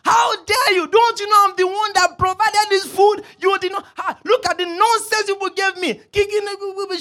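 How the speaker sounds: high, loud imitation of a furious woman; outraged shouting. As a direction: shout an indignant telling-off, hammed up. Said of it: "They were shouting".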